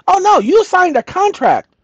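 high, smug officer voice; mock probation officer.

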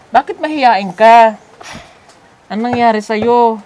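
loud, drawn-out teasing question. Real, not acted. Teasing the shy sponsored boy. (malakas at pahabang panunukso)

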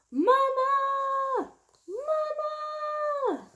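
high, sung-out calls of Mama; hollering for mama. Acted. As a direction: wail it out like a baby calling. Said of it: "hollers loudly for his mama".

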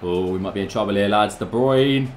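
ordinary talk

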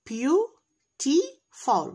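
syllable by syllable, very slow; sounding out words. Shown as a demonstration. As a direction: stretch every syllable apart. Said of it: "الكلمة بتتكون من syllables".

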